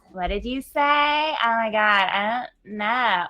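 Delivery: slow, drawn-out Malibu accent. Shown as a demonstration. Guest breaking into a dumb moment voice, Malibu accent. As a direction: stretch it out in clueless Malibu speak. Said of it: "I'll just break into that Malibu".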